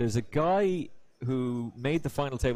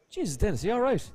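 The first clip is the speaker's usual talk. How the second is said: retold concern; 8 semitones higher than usual. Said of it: "the reaction he got was".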